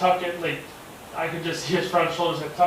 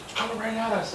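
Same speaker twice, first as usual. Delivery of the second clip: hushed alarm; 7 semitones higher, 4 dB quieter than usual. Retold or imitated. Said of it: "she's like".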